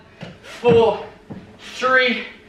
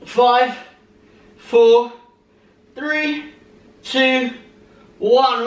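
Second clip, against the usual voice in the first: straining countdown; 5 semitones higher, 3 dB louder, much slower than usual. Real, not acted. Pushing through final countdown.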